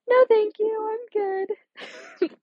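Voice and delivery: high, sing-song polite no thanks; mock polite refusal. Declining friends' invite in a high mock-polite voice. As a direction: turn them down sweetly, up high. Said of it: "And I said".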